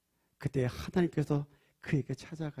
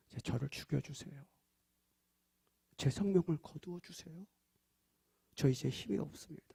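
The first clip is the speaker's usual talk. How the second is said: despairing prayer; 9 dB quieter than usual. Acted. Elijah's despairing prayer voiced.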